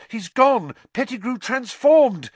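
high, loud alarmed yell. A narrator's reading. Harry tells Sirius that Pettigrew has escaped, yelling. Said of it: "Harry yelled".

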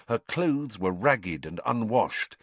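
plain reading voice

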